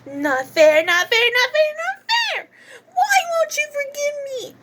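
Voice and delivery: hammed-up hysterical pleading; wailing tantrum. Acted character's tearful tantrum.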